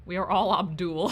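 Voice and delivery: hearty shouted joke, cracking up; loud laughing cheer. Real, not acted. Streamer shouts a meme line to chat, then loud laughter. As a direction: belt it out then burst out laughing.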